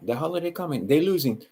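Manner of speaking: usual speaking voice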